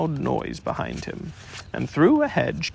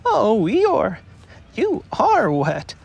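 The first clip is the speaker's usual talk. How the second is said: small Piglet voice; 9 semitones higher, 5 dB louder, much slower than usual. Acted. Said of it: "said Piglet".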